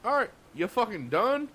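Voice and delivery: high, mocking jab; teasing. Arthur teasing the cook about crying, high and mocking. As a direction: needle him about crying. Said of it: "Arthur was like".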